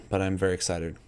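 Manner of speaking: usual speaking voice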